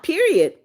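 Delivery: PERIOD pitched high, then dropping; emphatic finality. Real, not acted. Streamer laying down her rules, PERIOD high and final. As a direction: punch PERIOD high to close the matter.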